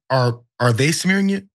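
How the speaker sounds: normal talking voice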